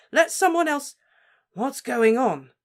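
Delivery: high, loud urgent call; alarmed shout into the room; frantic cry. Acted. Newcomer bursts in shouting, high and loud.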